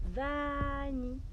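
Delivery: slow coaxing call to a pet; coaxing the rabbit. Shown as a demonstration. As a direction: drawl "Vanille." to the rabbit. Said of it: "vous dites".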